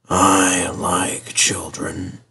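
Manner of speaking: very slow, soft whisper; hushed, contented old engine; happy whisper. A narrator's reading. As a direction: whisper it dreamily, savouring each word. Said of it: "he whispers happily".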